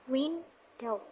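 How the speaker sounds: "window" split into two separate beats; syllable by syllable. Shown as a demonstration. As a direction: say "window" as win, pause, dow. Breaking word into two syllables.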